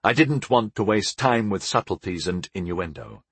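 ordinary delivery

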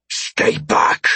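hoarse alien growl; rasping, threatening alien voice. A narrator's reading. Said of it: "The Altari growled".

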